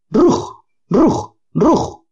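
high yelping "Rug, Rug"; dog's bark. Acted. Scared dog Boris barks at the Rugs by the bin, high yelps. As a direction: bark from under the porch. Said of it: "gritaba Boris".

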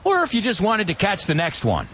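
high sarcastic quoted reply; sarcastic quote. Retold or imitated. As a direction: tease him with fake innocence. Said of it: "He says".

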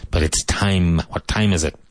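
ordinary talk, nothing marked

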